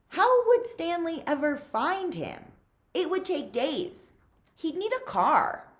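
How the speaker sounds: rising panic. A narrator's reading. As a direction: let the worry climb.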